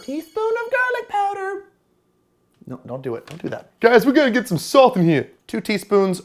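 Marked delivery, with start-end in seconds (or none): 0.1-1.6 squeaky high voice. Pretend. Repeats line in a squeaky voice.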